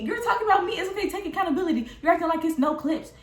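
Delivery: high-pitched, pressing her to own it; heated accusation. Retold or imitated. Pushing the friend on the phone to own it, high and heated. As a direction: call her out sharply.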